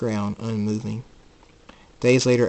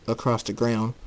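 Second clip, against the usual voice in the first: plain reading voice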